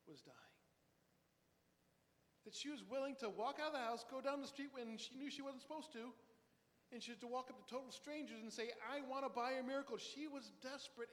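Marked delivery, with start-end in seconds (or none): 0.1-0.6 whispered, dropping away; hushed. Real, not acted. Preacher tells the congregation someone was dying, in a whisper. 8.9-10.0 higher, louder and quick desperate plea; urgent plea. Acted. Preacher re-voices the girl begging strangers, loudly and urgently.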